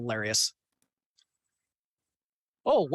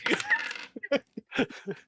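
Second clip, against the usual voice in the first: squeaky laugh; high-pitched giggle. Real, not acted. High giggle after tease.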